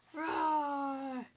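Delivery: high playful roar; mild hammed-up dragon roar. Dragon roars.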